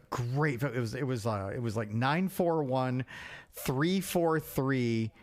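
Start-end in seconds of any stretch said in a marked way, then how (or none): none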